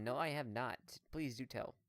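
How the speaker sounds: ordinary talk, nothing marked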